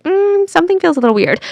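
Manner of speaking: high-pitched, wary hum into the words; doubtful. Acted. Listener sensing something is off, doubtful. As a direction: say it with hesitant suspicion. Acting out an uneasy gut feeling.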